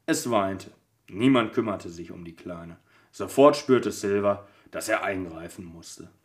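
calm storybook reading; steady, gentle storyteller reading; story narration. Acted. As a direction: read it like a bedtime story. Reads advent story aloud.

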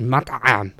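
mock an agonised, stretched ayn in "Mat'am"; painfully strained ayn. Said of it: "it sounded almost painful".

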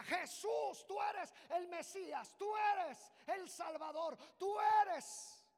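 loud high proclamation; proclaiming shout. Acted. Blind man proclaiming Jesus as Messiah, shouting. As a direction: declare it with everything.

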